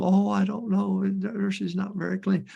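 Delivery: high mock whine of a fussy mother; high, put-upon mock whine; whiny parent. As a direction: mimic a fretful parent complaining.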